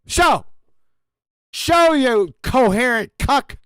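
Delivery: high, repeated barked demand, slow at the end; angry demand. Real, not acted. Demanding the caller prove his claim, angry high bark. Demanding opponent show proof.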